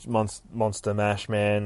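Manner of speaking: usual speaking voice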